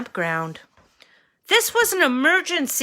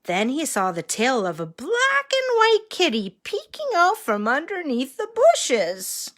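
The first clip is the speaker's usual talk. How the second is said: put-on reading to a toddler; 3 semitones higher than usual. A narrator's reading. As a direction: read it like to a small child. Reads story to children.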